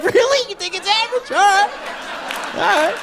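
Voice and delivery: very high, hammed-up wounded disbelief; mock-offended squeal. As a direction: squeak it out, pretending not to be hurt. Mock-offended reply to average remark.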